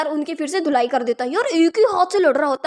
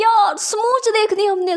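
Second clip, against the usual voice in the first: a lightly voiced rowdy onlooker, higher and slow; mock cheer from the crowd, drawn out; hint of a jeering bystander; teasing onlooker; 6 semitones higher, slower than usual. Crowd egging them on.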